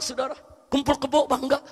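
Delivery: usual speaking voice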